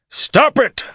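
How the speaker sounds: high, loud cry to stop; urgent shout. A narrator's reading. Bashir trying to stop Rand's lightning, shouting. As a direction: shout it out with full force. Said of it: "the Saldaean shouted".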